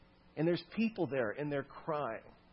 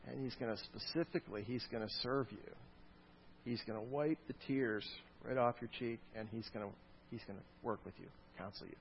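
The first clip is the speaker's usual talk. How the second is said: tender; 3 semitones lower, 9 dB quieter than usual. A narrator's reading. God tenderly comforting the grieving.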